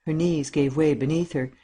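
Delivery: usual speaking voice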